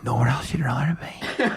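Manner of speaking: put-on whispered echo of the old man. Imitating the old man on the bus, whispered echo. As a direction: mimic the old man repeating back, breathy. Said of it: "he just goes".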